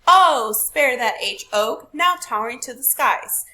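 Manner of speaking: loud sing-song recital to show the meter; rhythmic reading. Shown as a demonstration. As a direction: boom out each stressed beat. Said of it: "It has a little pitch".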